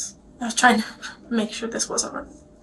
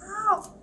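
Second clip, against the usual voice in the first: pained ow; 10 semitones higher than usual. Real, not acted. Sudden pain from a cut.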